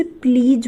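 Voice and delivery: "प्लीज" with a final j sound instead of z; mispronounced please. Shown as a demonstration. Teacher showing learners a common mistake, "प्लीज" ending in j. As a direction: say "प्लीज" wrongly, ending in a j as in jug. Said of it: "जो कि गलत है".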